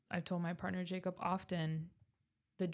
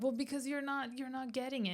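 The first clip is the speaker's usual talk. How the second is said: pitched up high, mock insistent; retold raised voice; 6 semitones higher than usual. Answering partner's why-are-you-yelling, mock raised voice. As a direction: replay pushing the point, voice climbing. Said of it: "why are you yelling?".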